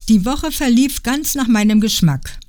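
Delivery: usual speaking voice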